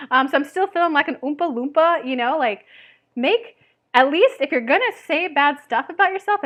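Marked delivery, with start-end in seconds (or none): none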